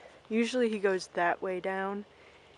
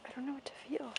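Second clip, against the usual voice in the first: voicing the pony in a hushed whisper; mock pony thought, whispered; 4 semitones higher, 12 dB quieter, much faster than usual. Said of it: "She's like".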